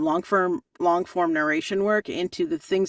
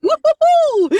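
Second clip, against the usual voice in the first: woo-hoo cheer; 10 dB louder than usual. Retold or imitated. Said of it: "I'm like".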